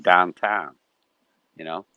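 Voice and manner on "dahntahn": "downtown" as "dahntahn", pressed a bit louder; both vowels of "downtown" flattened to "ah"; Pittsburgh "downtown". Shown as a demonstration. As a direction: local Pittsburgh "downtown", without the w glides. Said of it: "It's more like".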